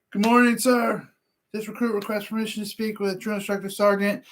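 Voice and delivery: high, formal recruit reporting in; barked sir yes sir. Retold or imitated. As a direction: snap off a recruit's greeting. Marine recruit addressing drill instructor.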